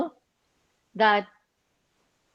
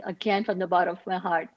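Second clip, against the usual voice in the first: ordinary talk, nothing marked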